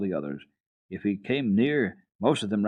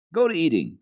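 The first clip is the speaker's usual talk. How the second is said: put-on high, louder boyish command; 9 semitones higher, 6 dB louder than usual. A narrator's reading. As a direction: bark it out like a bossy goat boy. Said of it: "commanded Peter once more".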